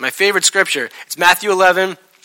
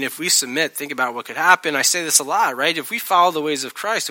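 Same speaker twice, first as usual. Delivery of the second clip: ordinary delivery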